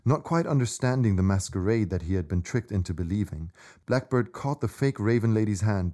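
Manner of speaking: ordinary delivery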